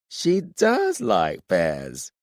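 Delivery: put-on very slow smug drunk; drawn-out tipsy triumph. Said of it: "You're drunk".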